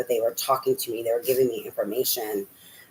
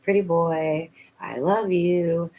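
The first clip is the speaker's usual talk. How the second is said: higher, slow, cooing sweet talk to a bird; pet talk; 5 semitones higher, slower than usual. Real, not acted. Cooing to her pet mockingbird.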